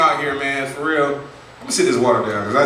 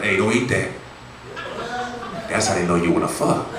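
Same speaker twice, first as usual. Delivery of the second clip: lower ex-con warning; 7 semitones lower, 4 dB quieter than usual. Retold or imitated. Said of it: "He was like".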